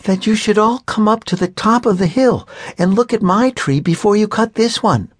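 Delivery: put-on high, bright-eyed child's voice; a young girl pushing her family to see her tree; eager little girl. A narrator's reading. Little sister begging her family, high and eager.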